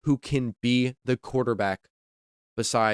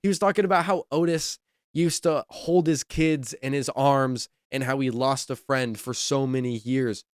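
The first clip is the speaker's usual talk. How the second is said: normal talking voice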